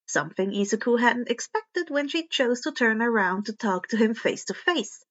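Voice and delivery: ordinary delivery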